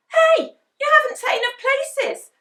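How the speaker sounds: high, loud protest; indignant Henry. A narrator's reading. Henry complaining to Mum, high and loud. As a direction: protest like an outraged child. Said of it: "said Henry".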